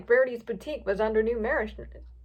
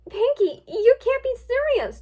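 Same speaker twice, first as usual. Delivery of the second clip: high, loud, stretched-out protest; alarmed shout; 13 semitones higher, 6 dB louder than usual. A narrator's reading. Twilight facing Pinkie with a knife, alarmed shout. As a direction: shout it in disbelief. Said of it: "Twilight shouted".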